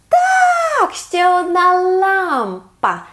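excited. Acted. Match found in memory game.

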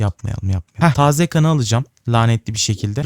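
normal talking voice

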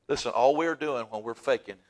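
ordinary talk

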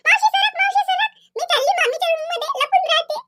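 put-on high, loud excited kid voice; a giggling child shouting to auntie and running to hide; playful child. Children to their aunt, a squealing excited kid.

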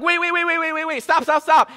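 high-pitched frantic string of words; panicking, begging for it to halt; mock panic. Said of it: "I am starting to panic".

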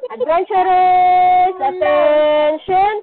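slow, loud drawn-out call to attention. Real, not acted. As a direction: call the class to order. Teacher calling class to attention.

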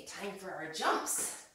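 ordinary talk

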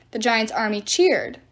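plain reading voice

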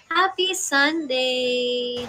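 Happy Sunday sung out slowly; slow drawn-out greeting. Shown as a demonstration. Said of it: "drawn-out greeting".

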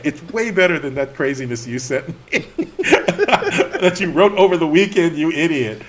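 loud, high laughing telling-off; laughing scold. Retold or imitated. Editor teasing the writer, laughing scold. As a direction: scold him while cracking up.

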